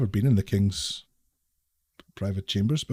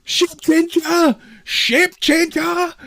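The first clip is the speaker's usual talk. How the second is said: high-pitched, drawn-out panicked shout; shouting in terror at the end of his rope; terrified shouting; 4 dB louder, much slower than usual. Acted. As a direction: freak out and shout the accusation. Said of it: "starts shouting".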